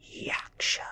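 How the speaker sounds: ordinary delivery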